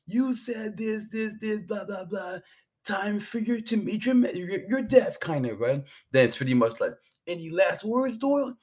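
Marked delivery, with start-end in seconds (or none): 0.1-5.2 hammed-up Scottish threat; mock Scottish accent. Fighter taunting his victim in a mock Scottish accent. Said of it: "They have their little accents". 7.3-8.6 high-pitched mock pastor asking last words; a hammed-up, higher preacher voice. Pastor asking the condemned Doyle, high mock voice.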